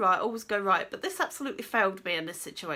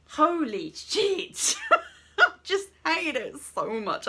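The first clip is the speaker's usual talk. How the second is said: laughing; 7 semitones higher, slower than usual. Real, not acted. Laughing through her frustrated rant.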